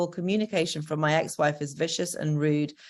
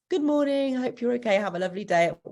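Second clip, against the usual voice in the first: high, sing-song sweet good-morning wish; acted cheery parent, starting high and easing down; nice cheery message; 8 semitones higher, faster than usual. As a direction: chirp a kind greeting to a child, pitched well up. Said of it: "send them nice".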